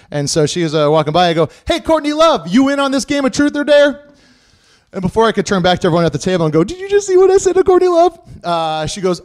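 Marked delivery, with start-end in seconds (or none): 1.7-4.0 high, impulsive call to a passerby; drunken calling out. Retold or imitated. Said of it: "you say it before thinking about anything else". 6.6-8.2 high, loud excited boast; giddy excitement. Retold or imitated. Giddy aside to friends at table.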